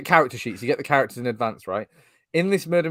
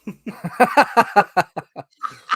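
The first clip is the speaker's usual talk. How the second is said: long, high cackling burst; loud high laughter; 8 semitones higher, 7 dB louder than usual. Real, not acted. Reacting to the joke about murdering Sam, loud laughter.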